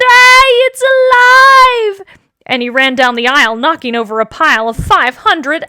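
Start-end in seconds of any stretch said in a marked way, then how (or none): 0.0-2.2 long, high, loud terrified scream. A narrator's reading. Man finding the doll is alive, screaming. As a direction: shriek it in panic, drawn out. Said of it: "The man screamed".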